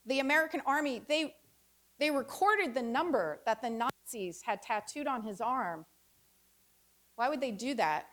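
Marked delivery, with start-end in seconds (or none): none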